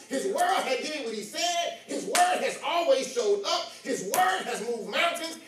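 rhythmic preacher's litany, building; preaching cadence. Acted. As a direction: chant each line like a preacher's refrain. Preaching litany of his word.

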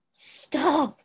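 a furious shout to stop her; snarled. A narrator's reading. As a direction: snarl the word with all your ferocity. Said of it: "Stiles snarled".

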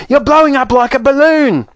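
very high, frantic exclamation; horrified screech. Acted. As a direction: screech it like a mother in panic. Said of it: "screeched Missus Beauregard".

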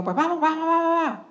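high-pitched, repeated mock agreement; imitated agreement. Woman agrees to talk inside the school, imitated agreement.

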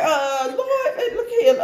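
laughing high-pitched "look here"; high pleading protest. Retold or imitated. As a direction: protest to the Lord in a squeak. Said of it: "Yeah, I said".

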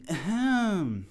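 ordinary talk, nothing marked

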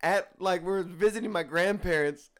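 normal talking voice

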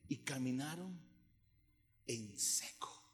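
low, soft, paused Bible quote; hushed awe. Real, not acted. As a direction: let the miracle land quietly.

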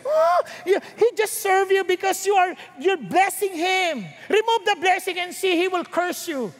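sneering high challenge to God; mocking Satan. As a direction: taunt God like a smug accuser. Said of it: "And Satan said".

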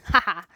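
short, loud "ha-ha"; mock gloating laugh. Podcaster torturing listeners who must Google it, loud gloating laugh. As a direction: burst out a loud, teasing ha-ha.

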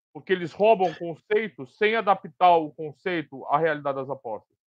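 ordinary talk, nothing marked

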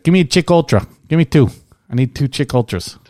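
hammed-up Boston guy ordering beers on the golf course; impersonating a friend's Boston accent, calling for beers. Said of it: "in his Boston accent".

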